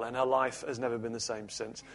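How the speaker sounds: ordinary talk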